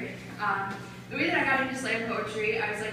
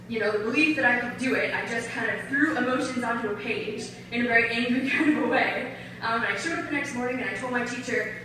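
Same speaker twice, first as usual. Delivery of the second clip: usual speaking voice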